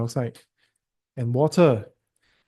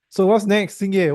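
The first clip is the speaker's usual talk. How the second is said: calling out; 6 semitones higher, 5 dB louder than usual. Real, not acted. Teacher calling on student.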